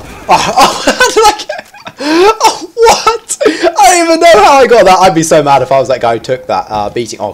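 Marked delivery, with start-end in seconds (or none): 0.0-5.0 very high, squealing astonishment; laughing disbelief. Real, not acted. Gamer amazed at his own lucky kill, laughing disbelief.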